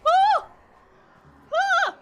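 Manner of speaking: high loud thrilled squeals; excited shriek. Real, not acted. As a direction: ecstatic disbelief. Nailing hard jump in game.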